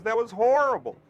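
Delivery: high, loud mock outrage; imitated director's yell. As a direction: holler it like an exasperated director. Director's retold outburst.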